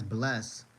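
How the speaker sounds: normal talking voice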